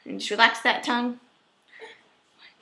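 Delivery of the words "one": whispering. Real, not acted. Counting strokes during therapy.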